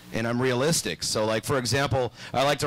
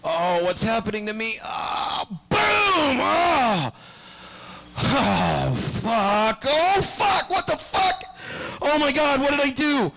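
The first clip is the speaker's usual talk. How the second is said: hammed-up horror at what just happened; panicked Superman; 10 semitones higher, slower than usual. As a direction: cry out in shrill terror, then keep on panicking. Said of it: "He's like, Oh".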